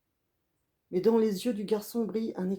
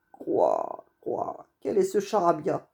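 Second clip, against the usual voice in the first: a lightly voiced croaking raven; 4 dB louder, slower than usual. Raven surprised to hear the boy talk like men, croaky. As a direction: croak out a puzzled quoi. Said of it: "une voix familière".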